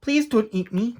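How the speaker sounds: pleading cry. Acted. Jack begging the giant in fright, high plea. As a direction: beg not to be eaten. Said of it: "cried Jack".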